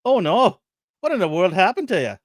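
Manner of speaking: a hint of an alarmed Irish doctor. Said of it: "Let me get my Irish accent out".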